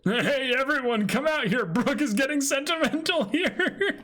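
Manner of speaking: very high laughing shout; high teasing call. Acted. Reading a crewmate calling everyone, high teasing laugh.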